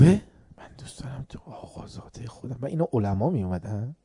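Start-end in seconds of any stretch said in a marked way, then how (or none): 0.0-0.2 surprised. Retold or imitated. Father reacts to refusal. 0.6-2.6 soft fatherly whisper; father gently whispering to his young son. Retold or imitated. Father quietly coaxing his son.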